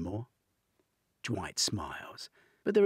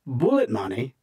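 loud, rising surprised echo; startled echo; 6 semitones higher, 13 dB louder than usual. A narrator's reading. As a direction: stop short in disbelief. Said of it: "They both pull up in harmony".

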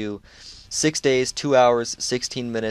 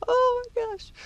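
squeaky high laughing, words breaking apart; can barely get words out through laughter; real giggling fit. Real, not acted. Streamer sees a huge unlock and laughs uncontrollably, high squeals. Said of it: "I don't know why I can't talk".

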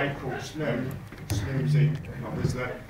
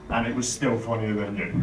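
ordinary talk, nothing marked

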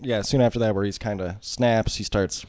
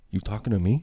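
quoting Travis, plain; 3 dB quieter than usual. Retold or imitated. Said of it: "the famous".

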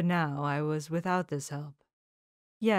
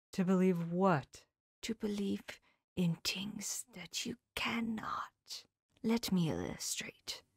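Van Helsing; 5 dB quieter than usual. Acted. Old professor whispering his lesson to his pupil, hushed. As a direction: confide each truth in a near whisper.

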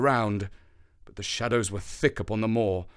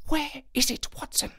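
high, shaken, voiced; 4 dB quieter than usual. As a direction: let the voice tremble with dread. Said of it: "the thrill of his voice ... shaken".

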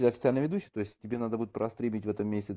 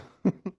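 short laugh; 12 semitones higher, 4 dB louder than usual. Real, not acted. Laughing at own joke.

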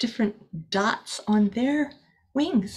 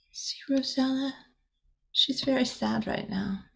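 softer, hushed aside to the little listener; 4 semitones higher, 6 dB quieter than usual. Real, not acted. Reader to child, hushed and soft. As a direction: lean in and say it softly.

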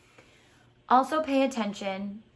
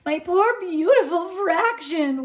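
slow, high, loud lament; the Baron mourns his stolen five ninths; wailing; 9 semitones higher, 6 dB louder than usual. A narrator's reading. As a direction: wail over the lost treasure. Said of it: "wailed Baron von Mathematique".

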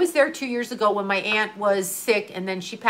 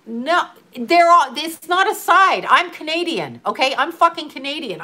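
raised, higher, emphatic protest; real exasperated indignation; indignant outburst; 6 semitones higher, 4 dB louder than usual. Real, not acted. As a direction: insist hard that she is Canadian. Heated rant about being Canadian.

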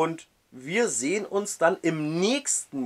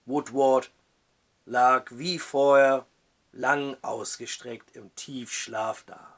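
usual speaking voice

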